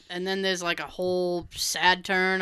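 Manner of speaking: normal talking voice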